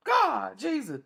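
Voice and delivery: high mock moan of God and Jesus. Imitating a woman running from her lover, high moaning. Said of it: "you running".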